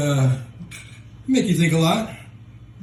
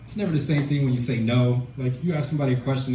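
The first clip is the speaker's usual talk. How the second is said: normal talking voice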